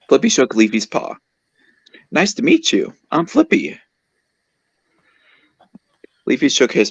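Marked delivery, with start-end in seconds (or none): 2.1-3.8 high-pitched introduction; lifted, high character greeting; friendly character voice. A narrator's reading. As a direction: voice a cartoon animal saying hello, pitched well up. Introduces himself in story.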